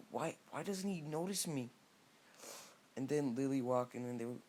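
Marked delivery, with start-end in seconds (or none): 0.1-1.7 high-pitched, hurt little lament; lightly acted lovesick complaint; mock dismay. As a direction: play a crushed admirer, voice lifted high. Hinata ignored by her crush.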